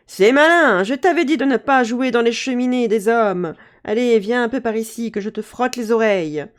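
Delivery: loud angry scolding; scolding fairy mother. Acted. Fairy mother scolding her daughter, loud and angry. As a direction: tell off a naughty child. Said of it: "Et elle crie".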